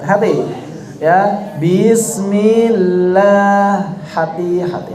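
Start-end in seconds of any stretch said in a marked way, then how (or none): 1.6-3.9 "Bismillah" with the vowels dragged very long; mock a badly drawn-out "Bismillah"; slow exaggerated mistaken reading; overstretched isba'. Shown as a demonstration. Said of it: "Itu namanya isba' semuanya".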